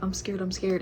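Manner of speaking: ordinary talk, nothing marked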